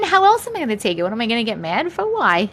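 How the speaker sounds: ordinary talk, nothing marked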